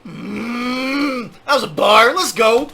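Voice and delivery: loud, high burst of hype; cheering the bar as it lands; fired-up call-out; hyped reaction. Real, not acted.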